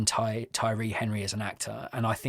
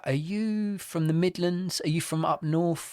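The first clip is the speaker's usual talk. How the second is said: mock puzzled questioning; 5 semitones higher than usual. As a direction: quiz the actor in exaggerated confusion. Mock questioning of actor's accent.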